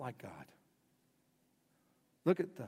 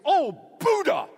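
much higher, louder, slow mock outburst; mock angry exclamation; 12 dB louder, slower than usual. Preacher mocks someone swearing by Buddha when mad, hammed-up. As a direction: ham up a fake curse.

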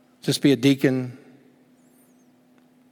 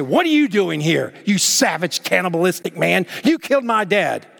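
raised pitch, mock outrage; heated, higher-pitched imagined accusation; angry accusation; 5 semitones higher, 3 dB louder than usual. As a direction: act out confronting a killer, indignant. Imagined accusing confrontation at reunion.

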